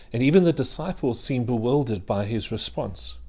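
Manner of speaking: ordinary delivery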